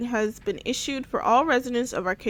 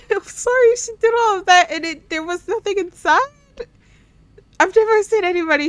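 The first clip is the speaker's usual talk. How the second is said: high, loud, laughing disbelief; 9 semitones higher, 6 dB louder, faster than usual. Real, not acted. Gamer cracking up at a character, high loud laughter. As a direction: gasp it out while laughing hard.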